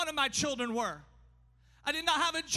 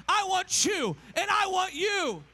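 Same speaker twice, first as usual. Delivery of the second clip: raised, louder preacher's cry; emphatic declaration; 5 semitones higher, 4 dB louder than usual. Acted. Preacher voices God choosing his child, emphatic declaration. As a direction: build to a high, forceful climax.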